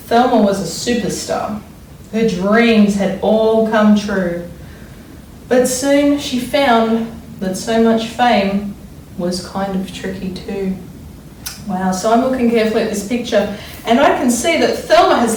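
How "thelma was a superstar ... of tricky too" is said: put-on expressive read-aloud for children; story reading. A narrator's reading. Teacher reads picture book aloud.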